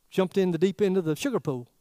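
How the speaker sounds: ordinary talk, nothing marked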